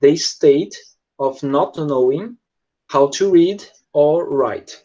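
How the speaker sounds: slow, deliberate reading aloud; slow dictation. Shown as a demonstration. Teacher rereading the definition slowly for students. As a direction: read it out word by word, slowly. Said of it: "dá pra responder".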